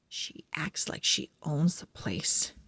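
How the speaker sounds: breathy whispered aside about the cat. Acted. Pug whispers about the bossy cat. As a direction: lean in and whisper it like a secret.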